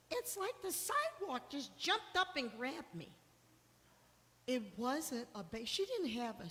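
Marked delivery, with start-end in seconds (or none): 0.1-3.1 higher, lightly voiced old mother, bemused; a daughter doing her confused mom's baffled excuse, pitched up; imitating her mother. Mother heard on the phone blaming the sidewalk, a lightly voiced higher bemused old lady. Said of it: "she's like".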